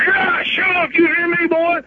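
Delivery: high sneering mock cop voice; mocking tough cop. Cop bullying the EMTs, high sneering mock voice. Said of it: "the cop's like".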